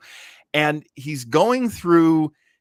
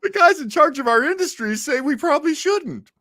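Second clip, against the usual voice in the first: high, louder comic spokesman voice; joking raised voice passing the blame; mock excuse; 13 semitones higher, 5 dB louder than usual. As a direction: make a hammed-up official excuse. Mock nation making excuses.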